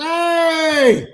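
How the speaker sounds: loud, high mock hail across the bar; imitated hearty greeting. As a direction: call out like a friendly drunk spotting a pal. Guy greets him loudly.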